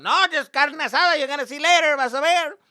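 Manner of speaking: high, very loud dad impression; shouting dad. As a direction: holler it like an excited Spanglish-speaking dad. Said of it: "my dad's like".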